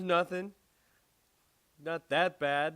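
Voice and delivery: high, loud mock backpedalling; imitated defensive denial. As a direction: play the friend hastily denying a problem. Friend's defensive reply, acted.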